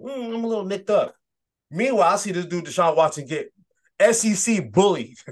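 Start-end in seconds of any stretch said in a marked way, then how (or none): 0.0-1.1 high, mock-whiny imitation; mocking sulky player. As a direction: imitate a player moping over a minor knock. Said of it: "annoying look on his face".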